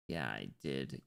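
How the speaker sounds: low, quiet admission; low-key. Pretend. Streamer confirming to himself, low and quiet. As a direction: say it low and softly. Repeats own answer, two ways.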